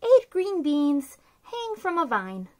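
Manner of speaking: ordinary delivery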